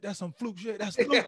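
high, loud scoffing; mocking yell. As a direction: ramp up into a jeering yell. Bart's trash talk escalates.

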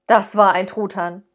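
usual speaking voice

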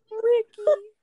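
shrieking laugh; loud laugh. Real, not acted. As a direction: burst out laughing. Laughs at the mic slip.

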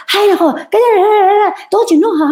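lightly hammed-up annoyed husband; imitating grumpy husband. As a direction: mimic him snapping back in a huff. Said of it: "Ganyan-ganyan siya".

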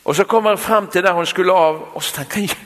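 usual speaking voice

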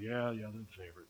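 sarcastic sibling. Retold or imitated. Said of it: "All your siblings are like".

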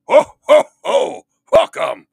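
booming jolly Santa laugh and shout. Acted. Santa voiced by host.

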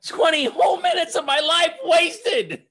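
high-pitched indignant shout; mock outrage. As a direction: shout it in exaggerated disbelief. Mock outrage at wasted time.

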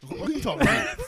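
loud, high-pitched challenge; mock outrage. Waiter squares up in skit.

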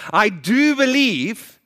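loud, high, slow cry of belief; exclaimed. Acted. Father exclaims to Jesus, a loud high cry. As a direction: cry it out, drawn out and pleading. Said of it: "the boy's father exclaimed".

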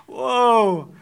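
retold stunned reaction; taken aback. Two friends watching a couple fight, taken aback. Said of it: "we're like".

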